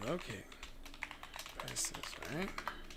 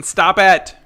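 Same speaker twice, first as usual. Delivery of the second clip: loud; 3 semitones higher, 16 dB louder than usual. Real, not acted. Playful warning to viewers.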